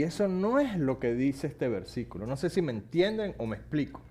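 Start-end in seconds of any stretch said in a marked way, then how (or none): none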